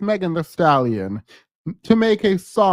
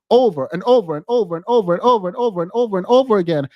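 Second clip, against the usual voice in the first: rapid-fire repetition; much faster than usual. Real, not acted.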